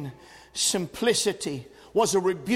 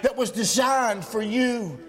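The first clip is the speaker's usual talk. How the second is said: high-pitched, forceful sermon delivery; impassioned pulpit cry; fiery preaching; 7 semitones higher, 3 dB louder than usual. Real, not acted. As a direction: preach it out hard to the congregation.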